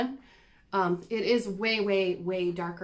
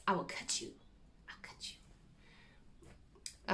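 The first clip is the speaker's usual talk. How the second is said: softly whispered mock menace; a little cute thing acting tough; mock tough threat; 13 dB quieter, faster than usual. Threatening her needle minder, lightly playing tough. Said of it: "cute things that try to act tough".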